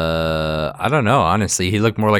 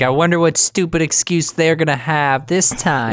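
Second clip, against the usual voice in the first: sarcastic mom; 9 semitones higher than usual. Retold or imitated. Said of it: "she's like".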